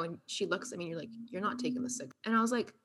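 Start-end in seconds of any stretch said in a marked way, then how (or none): 1.3-2.1 mom mode. Retold or imitated. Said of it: "I went into, like, mom mode".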